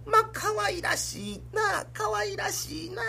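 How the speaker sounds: high, slow cooing woman's voice; cooing wife. Retold or imitated. Wife doting on a pilgrim child, high slow coo. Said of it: "言うて撫でてた".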